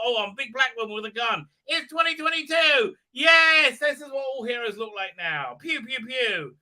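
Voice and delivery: high, fast mock bravado; mocking a heroine showing off; mocking impression. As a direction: crow it in a sneering parody. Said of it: "Takes them all".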